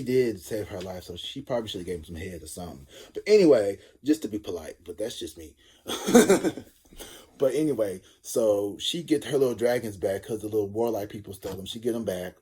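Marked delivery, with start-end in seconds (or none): 5.9-6.6 loud high laugh; burst of laughter. Real, not acted. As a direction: laugh out loud at your own joke. Laughs at own joke.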